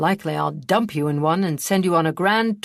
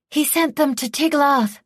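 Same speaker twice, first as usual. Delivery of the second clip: high, loud and gleeful outburst; excited cry; 10 semitones higher, 6 dB louder than usual. A narrator's reading. Boy blurts out good news before the squire can speak, excited cry. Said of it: "Tilal cried".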